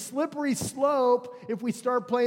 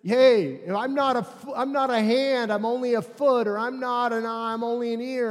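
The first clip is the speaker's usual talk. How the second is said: louder, higher voice of a body part feeling left out; acted-out grievance, pitched up; self-deprecating attitude; 3 semitones higher, 6 dB louder than usual. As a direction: complain loudly as the one who's only a foot. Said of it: "this attitude's like that says".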